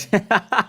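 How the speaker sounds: short high laugh at himself; caught-out chuckle; amused laugh. Real, not acted. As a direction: laugh at your own slip. Laughs at own slip.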